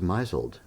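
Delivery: MISLED as "MY-zuld"; MISLED misread as MY-zuld; spelling pronunciation. Retold or imitated. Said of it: "it looks like misled".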